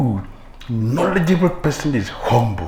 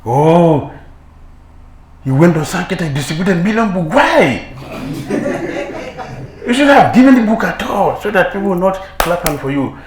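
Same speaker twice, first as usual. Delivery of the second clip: loud, higher envious rant with a laugh; jealous outburst; 6 semitones higher, 8 dB louder than usual. Acted. Preacher voices a jealous devotee, loud and higher, laughing. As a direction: spit out the jealousy, half laughing. Envious rival mocking book distributor.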